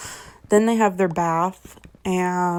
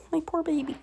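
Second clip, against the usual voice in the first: higher, softer cooing pity; tender pity; 9 semitones higher, 6 dB quieter, faster than usual. Retold or imitated. Vlogger pitying the boy in the book, soft high cooing. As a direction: coo over a hurt child. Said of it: "I'm like".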